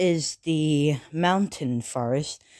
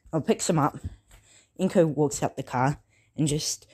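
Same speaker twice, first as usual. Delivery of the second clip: ordinary delivery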